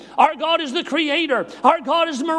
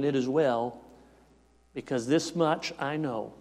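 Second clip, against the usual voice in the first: soft reflective preaching; 9 semitones lower, 4 dB quieter than usual. Acted. Quiet reflective close of sermon.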